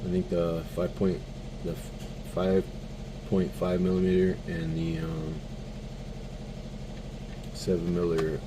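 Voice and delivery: halting, just woke up, tripping over words; stuttering. Real, not acted. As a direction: stumble and restart words sleepily. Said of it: "I apologize for my stuttering".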